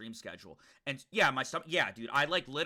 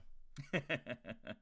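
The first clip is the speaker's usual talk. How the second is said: soft laugh; 4 semitones lower, 11 dB quieter than usual. Real, not acted. Laughing at own song.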